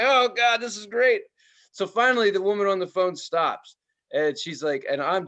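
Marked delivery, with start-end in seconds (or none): 0.0-1.2 high, loud delighted aside; the customer thrilled at the overheard rant. Retold or imitated. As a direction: relish the show. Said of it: "I'm like".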